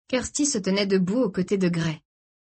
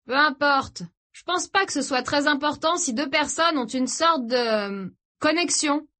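lightly voiced brother, higher-pitched; brother defending himself; 8 semitones higher than usual. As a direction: brush it off, then hesitate over the right word. (frère un peu gêné qui se justifie)

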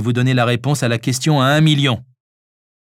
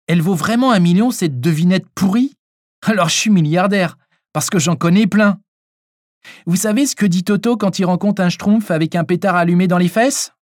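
lightly voiced child, higher-pitched; cheeky boy; 7 semitones higher than usual. A narrator's reading. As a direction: pitch it up as a cheeky young son. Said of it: "Théo déclara". (petit garçon effronté)

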